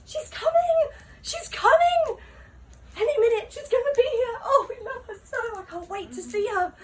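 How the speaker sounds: very high, loud squeal of adoring fans; giddy high-pitched fan frenzy; screaming frogs. Acted. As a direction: shriek like a frantic crowd of frogs awaiting their idol.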